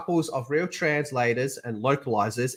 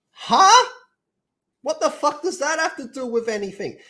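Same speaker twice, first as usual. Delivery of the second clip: baffled huh; 12 semitones higher, 4 dB louder than usual. Retold or imitated. Imitated baffled viewer.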